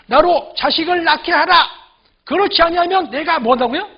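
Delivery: threatening demand. Acted. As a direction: act out a wife threatening her husband. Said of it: "남편을 막 협박하는 거예요".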